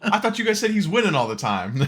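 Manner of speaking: high, loud, cracking up; laughing protest. Real, not acted. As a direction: protest through real laughter. Laughing at the losing streak.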